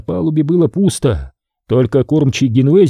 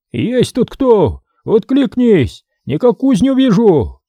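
higher, slow calling out; old man's call; 9 semitones higher, slower than usual. A narrator's reading. Old traveler hails the smithy at night, slow higher call. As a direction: call into the dark like a tired old man. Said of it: "Старческий голос, прерываясь кряхтением и кашлем".

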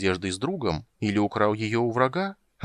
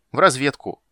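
proud; 5 semitones higher, 8 dB louder than usual. Acted. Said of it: "гордо ответил крыса".

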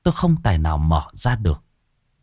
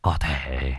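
put-on much lower and softer, a sorrowful old man; low sad voice; 6 semitones lower, 3 dB quieter than usual. A narrator's reading. Old man explaining the dead daughter's wish, low and sorrowful. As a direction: confess it heavily, grieving. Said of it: "cất giọng trầm buồn".

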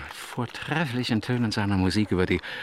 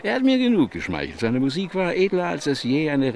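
effusive praise; 7 semitones higher than usual. Acted. King praises Bach effusively.

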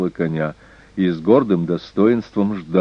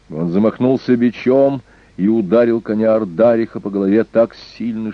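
ordinary delivery, nothing marked

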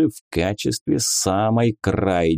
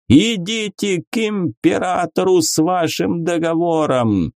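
gloopy bubbling slime voice; 7 semitones higher than usual. A narrator's reading. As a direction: rage like a bubbling giant from a portal. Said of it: "мощный булькающий голос".

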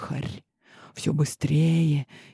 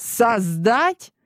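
snapping angrily; 5 semitones higher, slower than usual. Acted. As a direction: voice jumps high, outraged. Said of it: "Сорвался Адриас".

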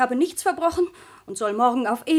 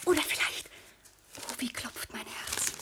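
breathless whisper; 10 dB quieter than usual. Acted. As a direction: whisper it as your heart races. Daughter hopes her lover arrives.

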